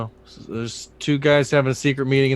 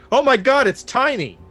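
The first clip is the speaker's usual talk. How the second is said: high, loud mock amazement; really loud exclamation; 11 semitones higher, 7 dB louder than usual. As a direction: blurt it out for everyone to hear. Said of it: "say really loud".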